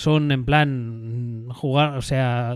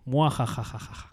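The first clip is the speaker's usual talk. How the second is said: mustache twirling villain; 7 dB quieter, slower than usual. Pretend. Villain laugh about Linux dominating.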